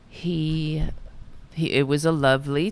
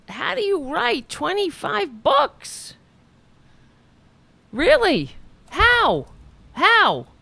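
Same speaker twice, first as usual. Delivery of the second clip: high, slow disbelieving question; higher, louder amazement; amazed; 11 semitones higher, 4 dB louder, slower than usual. Real, not acted. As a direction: ask it up high, in awe. Amazed at twenty-five books.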